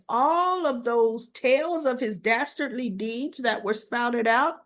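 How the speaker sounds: ordinary talk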